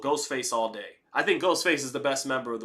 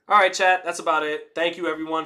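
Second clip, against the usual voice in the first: upbeat sign off; 4 semitones higher, 6 dB louder than usual. Real, not acted. Wrapping up the stream.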